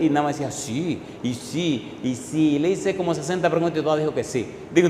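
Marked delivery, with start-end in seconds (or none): 0.5-2.6 much softer, barely there; softer meek replies; meek yes. Retold or imitated. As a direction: mumble agreement. Young man's meek replies.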